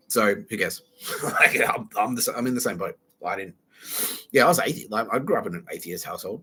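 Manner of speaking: ordinary delivery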